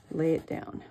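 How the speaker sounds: usual speaking voice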